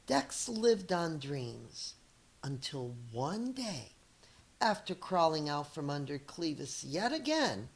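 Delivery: lively read-aloud storyteller voice; story narration. Acted. Teacher reading a picture book to her students, lively storytelling. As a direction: read it to young kids, expressive and clear.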